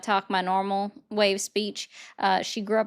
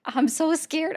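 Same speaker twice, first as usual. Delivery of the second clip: higher, sing-song mock-scared; mock fear; 9 semitones higher, slower than usual. Streamer teases chat in the hot seat, mock scared. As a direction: pretend to tremble, teasing. Mocking chat's threats.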